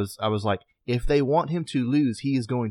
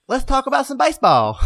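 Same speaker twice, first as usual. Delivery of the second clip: high, loud mock Southern twang; put-on Southern accent; 12 semitones higher, 5 dB louder than usual. Co-host kicks off baseball talk, Southern accent. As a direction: pitch it up in a playful Southern accent. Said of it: "we started the show that way".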